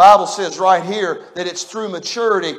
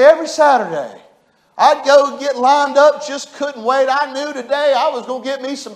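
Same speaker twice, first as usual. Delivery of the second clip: put-on high, loud, hopeful child's eagerness; eager orphan voice; 9 semitones higher, 7 dB louder than usual. Orphan longing for parents on Saturdays, high eager voice. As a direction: voice the boy who can't wait. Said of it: "he would cry telling this story".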